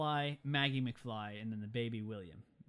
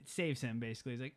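ordinary delivery

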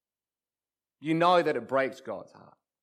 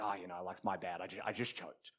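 low, much softer brush-off; sheepish excuse; 9 semitones lower, 14 dB quieter than usual. Acted. Player excuses his miss to his friend, low and sheepish. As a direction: own up weakly under your breath. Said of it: "I just say something like".

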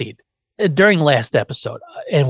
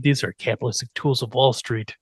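ordinary talk, nothing marked

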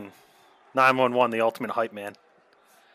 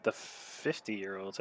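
reading the sign quietly; 3 semitones lower, 14 dB quieter, much slower than usual. Retold or imitated. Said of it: "Can't see".

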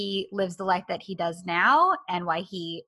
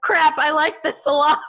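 loud, high-pitched mock dismay; flustered admission; 8 semitones higher, 7 dB louder than usual. Imagined man caught enjoying her attention, loud flustered blurt. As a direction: blurt it out louder and higher, amused. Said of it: "He's like".

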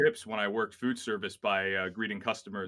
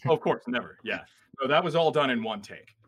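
sarcastic; 4 semitones higher, 6 dB louder than usual. Pretend. Mock praise of perfection.

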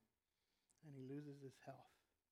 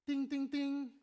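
high, loud mock bell chime; bell ding; 9 semitones higher, 7 dB louder, slower than usual. Preacher to congregation, a loud mock bell ding. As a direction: ring it out like a bell going off. Realizing Job's story was his.

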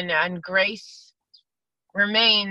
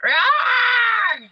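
big high shouted monster roar; Godzilla roar; 7 dB louder than usual. Pretend. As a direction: bellow it out like a giant monster. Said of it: "That's my Godzilla".

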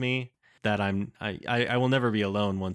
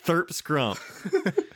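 laughing; 7 semitones higher than usual. Real, not acted. Laughing at the name.

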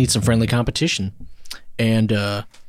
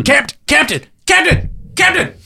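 tiny creature voice; 5 dB louder, slower than usual. Acted. Small creature calls captain.

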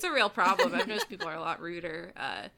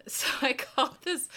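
laughing; 8 semitones higher, 5 dB louder than usual. Real, not acted. Laughing while starting the story.

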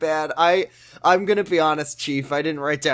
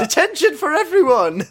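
high, loud put-on teacher voice; 13 semitones higher, 5 dB louder than usual. Mocking the teacher punishing the class, high put-on voice. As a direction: bark it like a fed-up teacher. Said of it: "the teacher says".

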